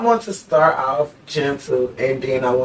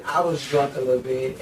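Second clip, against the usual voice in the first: ordinary talk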